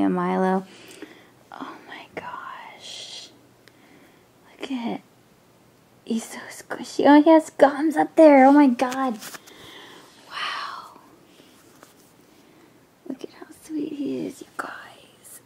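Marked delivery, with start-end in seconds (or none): 1.5-7.0 tearful whisper. Real, not acted. Overcome holding her new doll, tearful whisper. 13.1-15.4 soft, hushed whisper; awed whisper. Real, not acted. Showing viewers how sweet he is, awed whisper.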